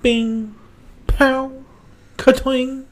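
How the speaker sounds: high, loud comic-book effects; imitated fight sounds. Mocking sixties Batman fights, high punchy effects.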